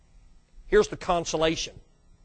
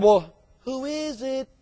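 slow, higher, wary call through the door; suspicious voice inside; 4 semitones higher, slower than usual. Retold or imitated. Homeowner asking who knocks at the door, wary and slow. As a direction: act the cautious homeowner asking. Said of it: "somebody must have said".

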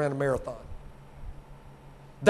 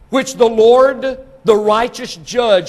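loud, slow, raised declaration; proclaiming scripture; 3 semitones higher, 7 dB louder, slower than usual. Real, not acted. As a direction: ring out each title slowly.

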